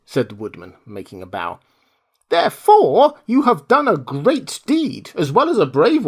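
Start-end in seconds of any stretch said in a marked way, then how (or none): 2.3-6.1 mouse queen. Acted. Queen voiced in story.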